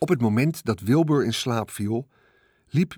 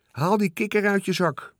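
raised high, bossy order; commanding; 8 semitones higher than usual. A narrator's reading. Sister ordering her brother off the swing, commanding. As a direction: order him like a big sister. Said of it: "beval Veerle".

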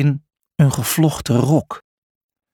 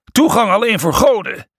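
raised, high-pitched official warning; commander's shout; 8 semitones higher, 3 dB louder than usual. A narrator's reading. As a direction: shout the rule at intruders. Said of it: "schreeuwde de commandant". (schreeuwend als een commandant)

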